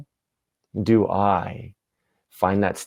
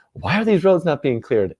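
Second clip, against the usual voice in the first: indignant townspeople; 8 semitones higher, 3 dB louder than usual. Acted. Voices the whole town complaining.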